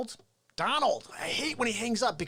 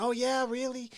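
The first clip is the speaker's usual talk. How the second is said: higher, younger movie-star voice; Al Pacino; 4 semitones higher than usual. Acted. Comic as seventies Pacino, high-end impression. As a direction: lift it up high and questioning. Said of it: "back in the seventies".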